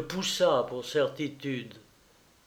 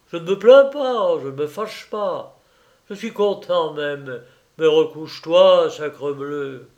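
teasing with bursts of loud emphasis; mocking and cheerful; 7 dB louder than usual. A narrator's reading. Husband coaxing his wife back to bed, lightly mocking. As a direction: cajole her briskly, a touch mocking. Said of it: "il riait, moqueur et gai".

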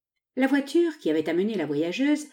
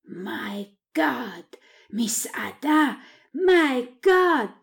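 slow, drawn-out exclamations building louder and higher; dumbfounded, stretching each word; stupefied; 5 semitones higher, 3 dB louder, much slower than usual. A narrator's reading. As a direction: let amazement grow with each phrase. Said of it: "La stupeur ne lui permettant pas".

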